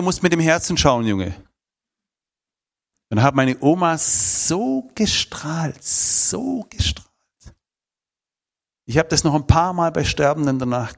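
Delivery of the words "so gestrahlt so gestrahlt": tender awe at grandma's glow; awed. Real, not acted. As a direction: savour the word in wonder. Grandma radiant at death.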